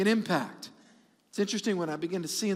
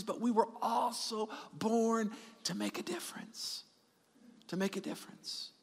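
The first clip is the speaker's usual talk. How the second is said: whispered, much softer; intense whisper; 8 dB quieter than usual. Real, not acted. Pastor whispering the key point to his congregation. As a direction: breathe it out as a secret.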